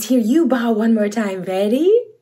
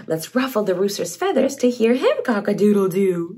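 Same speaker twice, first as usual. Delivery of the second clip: ordinary delivery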